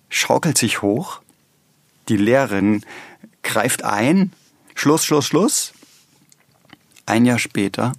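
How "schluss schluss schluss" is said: teacher calling stop. Retold or imitated. Teacher stops a quarrel.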